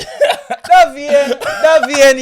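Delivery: high, loud name-calling through laughter; laughing exasperation. Real, not acted. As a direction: scold a friend while cracking up. Laughing exasperation at friend's example.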